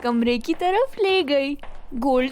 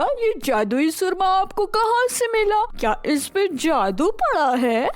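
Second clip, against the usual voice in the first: put-on higher, eager child voice; curious boy; 4 semitones higher than usual. A narrator's reading. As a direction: ask quickly and curiously.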